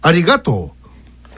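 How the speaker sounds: exaggerated Nagoya intonation on "ありがとう"; overdone Nagoya-style "ありがとう"; Nagoya accent. Shown as a demonstration. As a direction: demonstrate "ありがとう" in Nagoya dialect pitch. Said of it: "名古屋弁だと".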